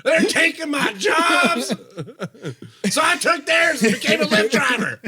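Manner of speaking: high, hollered mock grievance; hammed-up complainer yelling, laugh creeping in; boomer rant. Listener parodies driver griping about jobs, shouting. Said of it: "that's such boomer energy".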